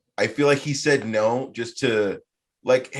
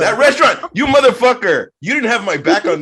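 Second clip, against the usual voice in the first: much higher, louder mock-outraged yell; playful angry shout; 12 semitones higher, 9 dB louder than usual. Real, not acted. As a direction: yell at your buddy in jokey outrage. Friend failed to back him up.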